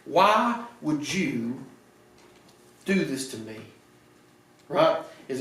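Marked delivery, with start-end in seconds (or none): 0.0-3.6 very slow, stretched "Why would you"; drawn-out accusing question. Retold or imitated. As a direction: drag out the hurt question. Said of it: "And he's like".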